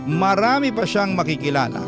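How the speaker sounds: usual speaking voice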